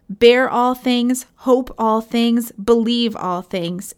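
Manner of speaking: each phrase drawn out slowly, Bear and hope lifted; slow solemn exhortation. Acted. Preacher urging his congregation to endure, slow and solemn. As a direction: lay down every phrase slowly, like a benediction.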